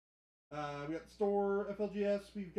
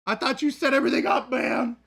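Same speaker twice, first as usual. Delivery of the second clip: high, loud complaint; mock-accusing shout; 6 semitones higher, 11 dB louder than usual. Streamer blaming colleague for mic setup, mock-accusing shout. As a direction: holler it in protest at a coworker.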